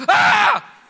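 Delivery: sudden piercing high scream; child's scream. Retold or imitated. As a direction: shriek like a startled little boy. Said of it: "he turns over and goes".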